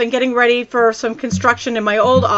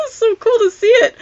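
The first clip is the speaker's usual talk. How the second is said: giggling; 14 semitones higher, 6 dB louder than usual. Real, not acted. Vlogger still giddy about her card, laughing. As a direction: still high, laughing through the words. Giggling after excited retold squeal.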